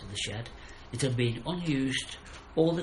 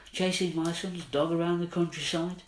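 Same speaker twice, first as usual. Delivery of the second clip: higher, indignant angry demand; angry shout; 6 semitones higher than usual. Acted. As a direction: keep shouting at the hunters. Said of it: "he shouted, he yelled".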